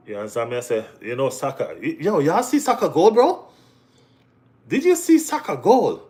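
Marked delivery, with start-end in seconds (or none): 1.8-6.0 high, loud and thrilled, calling out to the chat; excited. Real, not acted. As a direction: shout it out, buzzing about the goal. Hyped about Saka's goal.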